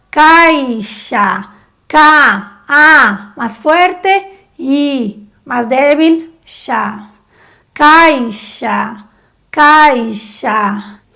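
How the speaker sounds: caixa with strong A and weak I; diphthong demo. Shown as a demonstration. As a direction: stress A, soften I in caixa. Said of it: "más fuerte ... más débil". (diptongo en caixa, A fuerte e I débil)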